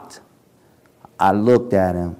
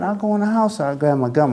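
normal talking voice

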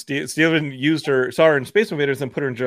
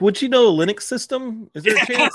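mock eager question; 10 semitones higher than usual. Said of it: "he's like".

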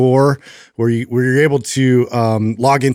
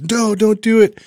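put-on high, panicky child; kid pleading; 10 semitones higher than usual. Son begging dad not to ask, high pleading. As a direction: beg like the boy would. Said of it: "I was".

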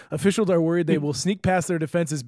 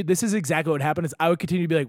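normal talking voice

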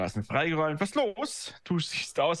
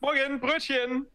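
fröhlicher Ruf; 12 semitones higher, slower than usual. Acted. Walter ruft Gruß beim Hereinkommen.

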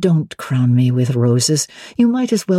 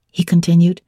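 ordinary delivery, nothing marked

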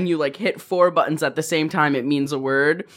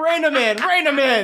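high, urgent plea to the bench; mock courtroom command; 7 semitones higher than usual. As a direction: call out high, hammed-up. Mock justice calling for restraint.

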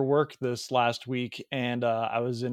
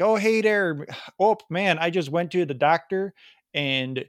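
put-on Wisconsin accent; 2 semitones higher than usual. Man confiding in his buddy, imitated Wisconsin accent. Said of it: "I can do the Wisconsin accent pretty well".

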